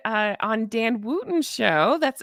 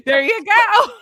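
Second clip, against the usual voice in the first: bright laughing encouragement, higher; 9 semitones higher, 8 dB louder, slower than usual. Real, not acted. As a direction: laugh through the words.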